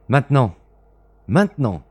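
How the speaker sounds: loud, sharp command. Acted. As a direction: bark the order twice. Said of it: "dit-il".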